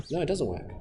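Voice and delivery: whispering to himself in frustration; whispered frustration. Real, not acted. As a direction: breathe it out, defeated. Software failing, whispered.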